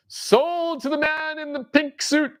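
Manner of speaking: slow, high auctioneer call. Pretend. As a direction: bang the gavel and announce the winner.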